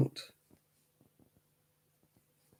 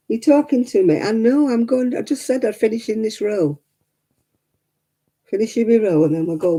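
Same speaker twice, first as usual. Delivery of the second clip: talking to the dog; 6 semitones higher than usual. Real, not acted. Answering her whining dog.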